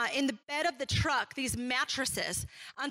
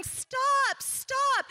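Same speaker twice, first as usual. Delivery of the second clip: much higher, mock-shouted warning; retold yelling; 11 semitones higher than usual. Roadside bystanders yelling at the wife to stop. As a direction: call out to a driver who can't hear.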